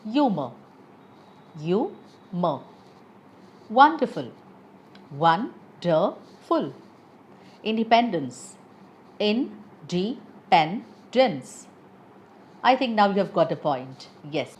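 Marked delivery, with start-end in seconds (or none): 1.6-2.6 "Humor" broken in two, with a clear pause between syllables. Shown as a demonstration. Word split to show syllables. 5.1-6.7 "Wonderful" slowly, in three separated syllables. Shown as a demonstration. Word split to show syllables. 9.2-10.2 syllable by syllable. Shown as a demonstration. Word split to show syllables.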